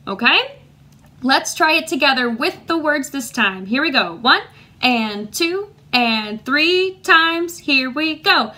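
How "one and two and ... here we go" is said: slow, measured count-in on the beat. Shown as a demonstration. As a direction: stretch each word to set the tempo. Teacher counts the class in.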